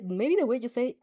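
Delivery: usual speaking voice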